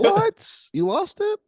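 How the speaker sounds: high, loud shocked What; floored to hear it's gone; shocked disbelief. Real, not acted. Hears the recording got lost.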